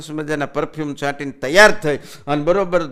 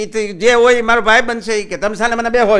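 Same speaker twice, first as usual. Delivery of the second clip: loud, higher retort; loud cheeky reply to the boss; shouting back; 6 semitones higher, 7 dB louder than usual. Acted.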